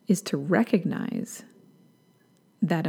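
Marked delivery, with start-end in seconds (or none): none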